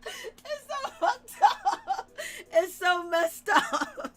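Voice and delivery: slow words broken by laughter; helpless laughter; laughing hard. Real, not acted. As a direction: laugh through it, drawn out. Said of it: "laughing at the scene".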